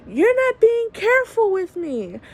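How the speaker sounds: high, sharp complaint at the medic; snapping at him in pain; yelling at the careless paramedic; retold yelling. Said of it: "felt kinda bad for yelling at him".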